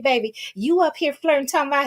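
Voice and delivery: usual speaking voice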